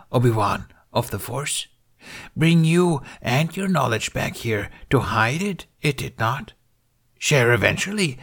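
high, croaky little Jedi master; Yoda voice. A narrator's reading. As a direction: speak as a wise old Yoda. Yoda speaking in the story.

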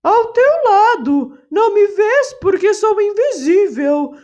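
a bird putting on a holy voice from the leaves; parrot imitating a saint. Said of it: "imitando a voz de santo".

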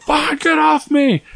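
half-joking frantic cry; mock panicked yell. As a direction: yelp it out high, like a kid pinned down. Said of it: "I was like".

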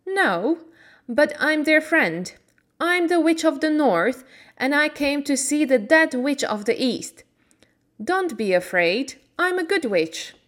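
a hint of a friendly old fairy woman; kindly good witch. Good witch thanking Dorothy, lightly voiced. Witch of the North introduces herself.

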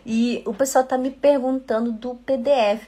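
usual speaking voice

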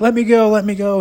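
high, loud mock wail of a scared kid; near tears. Little brother caught by the wrist, mock tearful pleading. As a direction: beg to be let go like a terrified boy. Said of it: "near tears".

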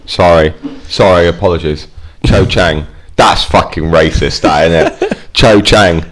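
normal talking voice

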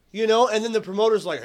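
normal talking voice

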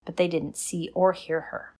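ordinary delivery

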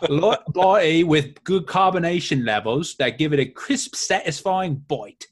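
slow, hammed-up Australian accent reading the review; mock Australian accent. Host reading a beer review in a joke Australian accent. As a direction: lay on a jokey Australian accent. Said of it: "Now I'm Australian".

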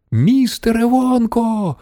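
high, drawn-out call; yelling over noise. A narrator's reading. Charlie calls to Mister Wonka over the din, high drawn-out yell. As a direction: call out high, stretching the words. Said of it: "заволав він, перекрикуючи галас".

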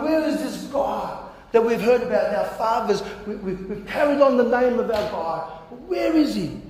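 high, pleading demand; frustrated plea. Retold or imitated. Gideon pleading about the God of their fathers, high and frustrated. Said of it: "He was pent up and frustrated".

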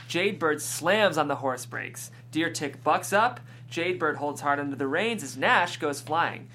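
ordinary talk, nothing marked

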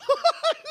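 a sudden high, joyful laugh; high burst of laughter. Real, not acted. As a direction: laugh out in delight. Laughs after the shout.

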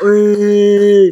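loud rowdy "Oi!" from the room; jeering shout. Retold or imitated. Teammates jeering the accused at the kangaroo court, loud shout. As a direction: bellow it like a dressing room of teammates.

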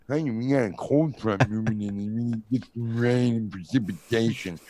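lightly voiced mumbling weather guy; sloppy weatherman. Shown as a demonstration. Mock TV weather report, unclear and sloppy. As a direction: show how a weatherman should not sound. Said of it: "can't give the weather with that voice".

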